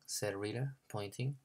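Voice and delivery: ordinary delivery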